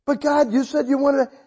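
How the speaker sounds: high, loud objection; protesting. Retold or imitated. Preacher argues with God over the failed healing, protesting. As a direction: plead your case, re-enacting the protest.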